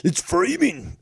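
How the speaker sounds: high, loud sarcastic repeat; mocking echo. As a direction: parrot his word in scorn. Mockingly echoes the caller's term.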